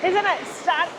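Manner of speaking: losing her voice; hoarse, voice giving out. Real, not acted. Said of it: "sorry, I got no voice today".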